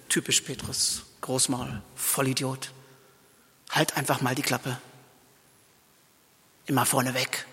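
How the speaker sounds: the disciples' silent scorn for Peter; scornful whisper. Acted. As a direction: whisper low and soft, full of contempt. Others' silent judgment of Peter. (verächtliches Flüstern)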